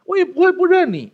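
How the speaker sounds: high, loud mock vow of loyalty; Peter's boast. Disciple vowing loyalty to Jesus at the Last Supper, high mock bravado. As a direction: swear it with blustering certainty. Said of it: "上一个这样做的人在最后晚餐".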